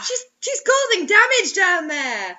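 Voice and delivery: high, slow, crying protest; mock horrified wail. Real, not acted. Co-host wailing in pretend horror at the story.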